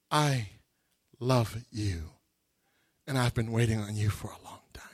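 soft, low whispered voice of God; a hushed loving whisper; God's whisper. Retold or imitated. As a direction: whisper it tenderly, like a secret.

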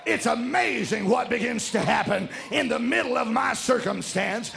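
loud, driving preacher delivery; full-force preaching. Real, not acted. Preacher telling congregation about singing in trouble, full-force preaching. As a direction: preach it out with rising fervor.

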